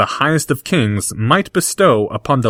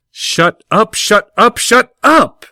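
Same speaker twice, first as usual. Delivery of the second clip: rising, louder shut up; building protest; 12 semitones higher, 4 dB louder, slower than usual. Acted. Draco to Harry, building from protest to shout. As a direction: get higher and louder each time.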